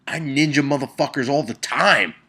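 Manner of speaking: normal talking voice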